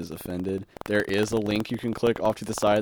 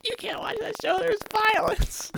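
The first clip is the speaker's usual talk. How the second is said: mock parent voice. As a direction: play the disapproving parent, squeaky and high. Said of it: "My parents would be like".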